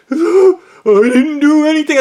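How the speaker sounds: high, loud, slow blubbering denial; mock whiny crying. Coworker denies it to the narrator, mock crying. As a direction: whine it out like a sobbing child.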